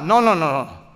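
ordinary talk, nothing marked

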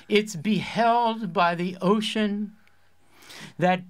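mock-lyrical high school lit mag reading; poet voice. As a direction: intone it slowly like a grand poet. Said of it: "I'm gonna do my poet voice".

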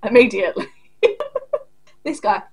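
usual speaking voice

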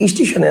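"স্টেশনে" said as ish-ti-sho-ne; everyday pronunciation. Acted. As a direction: open "স্টেশনে" on an added i. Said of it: "আমরা কি বলি".

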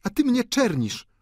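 very high, cool woman's voice; cool high-voiced accusation; cold baroness. A narrator's reading. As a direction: state it coolly, pitched high. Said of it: "powiedziała chłodno baronowa".

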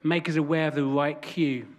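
unhurried spoken prayer, leaving room to breathe. Real, not acted. Leads congregation in prayer.

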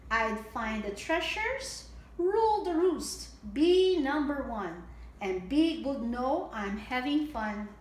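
bright, sing-song storybook read; lilting picture-book reading; reading to children. A narrator's reading. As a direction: read it out like storytime for little ones.

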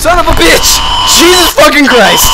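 high, loud frightened cursing; panicked swearing. Real, not acted. Player just caught by Slender, panicked cursing. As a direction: swear in shock and fear.

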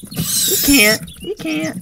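high mocking you can't; sing-song gloat; taunting. Gamer gloating at a mob, taunting.

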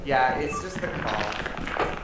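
normal talking voice

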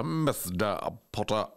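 slow, drawn-out Alan Rickman drawl; Snape impression. Doing Snape for his co-host, slow and drawn out. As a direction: draw it out like Snape. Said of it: "Snape comes in and he's all".

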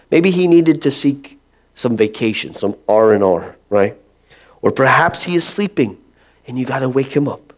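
ordinary delivery

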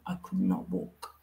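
whispering how bad it was; hushed whisper. Real, not acted. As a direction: drop to a whisper for weight. Emphasizing inability.

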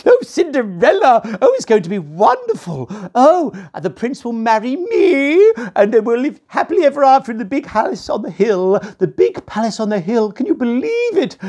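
high, gushing ugly sister; excited sister. A narrator's reading. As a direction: swoon over the prince. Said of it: "ever so excited".

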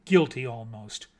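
plain reading voice

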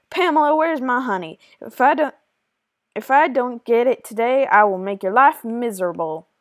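loud, threatening Western bully; Western accent. Acted. Junior demands honey from Pamela, loud Western accent. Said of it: "I tried to do a Western accent".

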